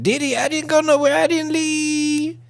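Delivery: put-on high, pleading girly voice, last words stretched; mock a loyal girlfriend begging. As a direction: falsetto parody of a devoted girlfriend.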